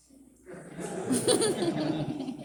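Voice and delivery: loud high-pitched cackling laugh; helpless loud cackle; big laugh. Real, not acted. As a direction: burst out laughing at the memory. Laughs recalling uncle finding him.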